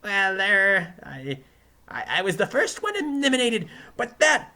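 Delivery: high, loud, stammering defensiveness; flustered excuse. Acted. Defendant admitting defeat to the judge, flustered. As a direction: stumble into a high-pitched excuse.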